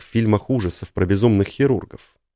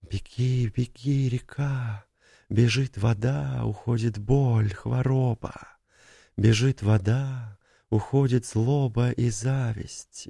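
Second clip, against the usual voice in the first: soft eerie whispered chant; whispering water; 3 semitones higher, 6 dB quieter, slower than usual. Acted. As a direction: breathe it like murmuring water. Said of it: "вода шептала".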